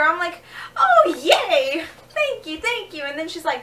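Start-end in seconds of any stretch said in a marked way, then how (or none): 0.8-3.0 higher, gushing thank-yous; delighted thanks. Retold or imitated. Flattered thanks to the security lady, higher and gushing. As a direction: gush like you got a compliment.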